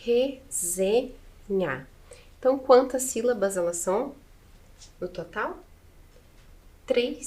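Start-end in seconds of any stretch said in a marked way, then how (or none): 0.0-1.9 very slow, each syllable of Resenha drawn out; slow syllable blending. Shown as a demonstration. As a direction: stretch Resenha slowly, syllable by syllable, like a teacher. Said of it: "Então, a primeira sílaba da palavra". (Resenha bem devagar, sílaba por sílaba)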